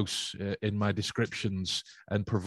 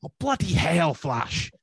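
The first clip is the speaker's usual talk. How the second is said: loud, high mock exasperation; exasperated friend; 7 semitones higher, 5 dB louder, slower than usual. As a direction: throw up your hands at Flash. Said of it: "she'd turn around and go".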